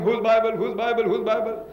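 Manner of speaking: half-hearted imitation of calling round for an owner; mock shouting. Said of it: "You're gonna start shouting".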